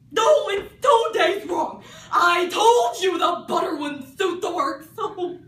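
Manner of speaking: loud, high, slow manic question; mad Hatter. Acted. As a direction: bellow it, laughing madly. Hatter scolding.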